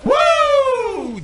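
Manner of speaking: high piercing wrestler woo; Ric Flair. Retold or imitated. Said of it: "Give me two claps and a Ric Flair".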